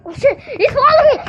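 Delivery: loud, high alarmed cry; panicked yell. Real, not acted. Kid yelling that someone is following him, alarmed shout.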